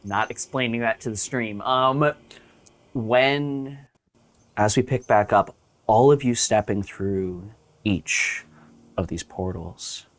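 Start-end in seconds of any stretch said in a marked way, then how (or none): 2.9-10.1 slow, low game master narration; scene narration. Acted. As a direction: set the scene slowly. GM scene setting over music.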